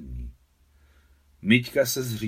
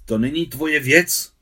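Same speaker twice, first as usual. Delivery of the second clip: loud, higher-pitched snarl; growled retort; 6 semitones higher, 8 dB louder than usual. A narrator's reading. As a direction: snap it back defensively. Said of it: "zavrčel Miťka".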